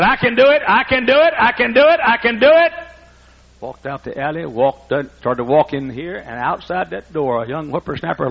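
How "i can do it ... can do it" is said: high, loud repeated I can do it; self pep talk. Retold or imitated. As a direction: psych yourself up, chanting it. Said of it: "and I said".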